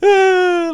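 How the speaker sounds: high, loud imitated crying wail; mock bawling. Imitating a kid crying in jail, mock bawling. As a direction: wail like a crybaby, mockingly. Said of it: "like that and shit".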